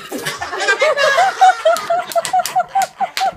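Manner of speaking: high, loud, squealing laughter; shrieking laugh. Real, not acted. Telling the gecko landed on him, shrieking laugh. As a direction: let loose a long shrieking laugh.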